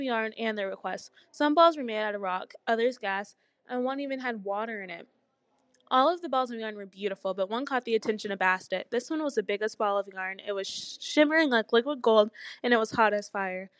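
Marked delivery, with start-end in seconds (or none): none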